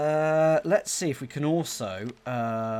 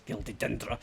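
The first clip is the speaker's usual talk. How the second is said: put-on Scottish accent; 5 semitones lower, 6 dB quieter than usual. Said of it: "that was more Scottish".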